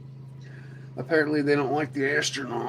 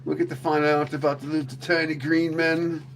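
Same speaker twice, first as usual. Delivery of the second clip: ordinary delivery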